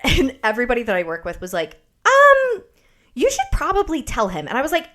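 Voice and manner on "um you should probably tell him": high, loud mock coworker voice; coworkers imitated. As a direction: put on a prim coworker's voice. Coworkers voiced giving advice.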